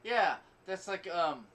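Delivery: normal talking voice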